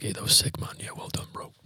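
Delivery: hushed, breathy congratulations; quiet mock whisper from the lads; whispered praise. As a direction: whisper it like a sneaky compliment. Band mates congratulating quietly.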